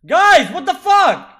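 high, loud, drawn-out protest; real exasperated outburst, slow and loud; exasperated shout. Real, not acted. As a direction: shout at your teammates in disbelief. Teammates fail to revive player.

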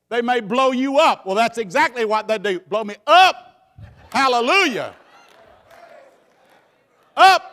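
loud rising preaching shout; preaching at full force. Acted. Preacher defying terrorists, loud and ringing. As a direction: burst out with it.

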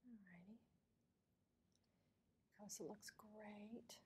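slow whispered remark; whispering. Real, not acted. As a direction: murmur it slowly under your breath. Quiet note during mouth exam.

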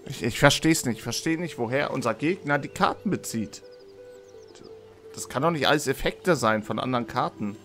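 ordinary delivery

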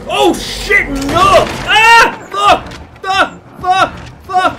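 very loud, high-pitched panic; panicked screaming. Real, not acted. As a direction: scream each word as you get caught. Caught by the hunter in game.

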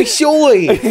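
put-on high, loud Philly-accented Spanish; hammed-up Philadelphian butchering Spanish; Northeast Philly Spanish. Mocking the Philly guy in Cancun, thick Philly Spanish. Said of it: "But the Northeast still comes out".

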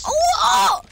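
high, disbelieving yell; stunned shout. Real, not acted. Stunned by kill.

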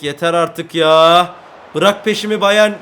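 loud, slow fed-up yell; furious loud plea at the opponent; exasperated shout. Real, not acted. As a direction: tell the rival team to back off, loudly.

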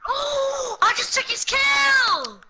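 high, drawn-out shout; excited yell. Real, not acted. Player boasting of a stolen kill, excited yell. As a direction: yell it out, stretching the end.

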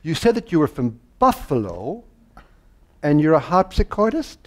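lightly imitated incredulous woman, pausing between the halves; puzzled woman. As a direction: puzzle over two things that won't fit. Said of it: "she had these funny kind of".

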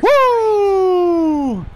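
very high, loud whoop; huge high cheer; victory whoop. Real, not acted. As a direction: whoop in triumph after scoring. Celebrating a goal.

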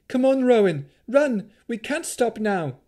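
high, urgent shout. A narrator's reading. Mother glancing back at her daughter as they flee, high urgent shout. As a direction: yell to hurry a lagging child along. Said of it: "Sariel shouted".

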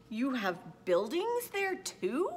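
slow, high, amazed question; wide-eyed storybook disbelief; astonished gasp. A narrator's reading. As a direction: stretch it out in wonder, swooping up at the end. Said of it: "Horton gasped".

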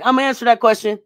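high, loud bold reply; hammed-up eager teammate; mock Dom. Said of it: "And then Dom was like".

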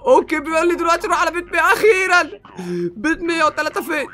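loud, very high triumphant yelling; excited shouting. Real, not acted. Player learns at last to open locks, shouting in excitement.

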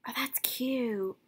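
soft whispered gush; whispering. Real, not acted. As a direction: whisper it tenderly.

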